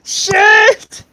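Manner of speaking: high, loud alarmed cry; shouting. Real, not acted. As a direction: yell it out in panic. Player yells in alarm.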